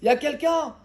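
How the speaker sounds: high, loud call from the bottom of a pit; desperate shout into the dark; crying out for help. Acted. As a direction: shout up for someone to hear you. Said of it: "Il a crié".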